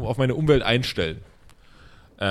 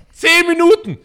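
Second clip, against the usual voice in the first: outraged yell, much higher and louder; mock rage; 9 dB louder, slower than usual. Man fuming over ten minutes of drumming, loud mock yell.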